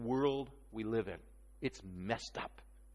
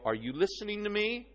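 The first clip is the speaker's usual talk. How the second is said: put-on higher-pitched parent demanding a child's attention; scolding parent; 7 semitones higher than usual. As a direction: play a parent checking the child is listening, rising at the end. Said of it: "they grab you by the cheek".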